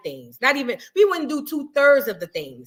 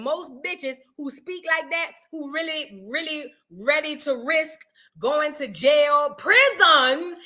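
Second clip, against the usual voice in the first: ordinary delivery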